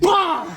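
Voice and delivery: sharp high "bah" for a hit; punch sound effect. Retold or imitated. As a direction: shout the hit.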